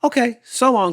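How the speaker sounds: high, loud breezy farewell; cheerful goodbye. Acted. Tumbleweed cheerfully leaving Hank. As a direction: toss off a happy goodbye. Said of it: "as she cheerfully".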